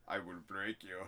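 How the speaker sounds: put-on low Russian-accented threat; low, far-from-natural Russian villain voice. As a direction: menace them in a deep Russian accent. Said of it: "what did you go fucking Russian".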